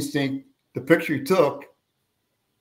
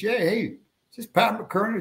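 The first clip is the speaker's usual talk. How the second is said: voicing the caller; 9 semitones higher than usual. Caller voiced on phone.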